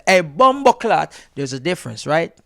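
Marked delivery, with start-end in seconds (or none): 0.1-1.1 loud, higher Jamaican exclamation; Jamaican patois. Shown as a demonstration. Showing the switch into Jamaican, loud patois. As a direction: burst into broad Jamaican patois. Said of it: "and then me say".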